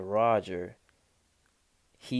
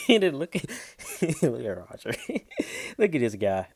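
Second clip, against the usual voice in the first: talking through real laughter; cracking up; 4 semitones higher, 3 dB louder than usual. Real, not acted. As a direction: laugh helplessly between the words. Cracking up at Roger.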